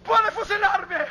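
very high, loud, drawn-out cry; panicked shouting. Acted. Pastor daring the guard to shoot him, high desperate shout. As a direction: cry it out slowly at the top of the voice. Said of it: "Tienes que gritar".

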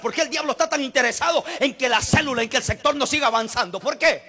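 loud, driving rhetorical questions; full-force preaching. Real, not acted. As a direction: hammer each repeated question at the congregation.

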